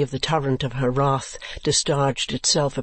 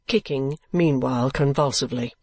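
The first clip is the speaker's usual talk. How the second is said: ordinary delivery, nothing marked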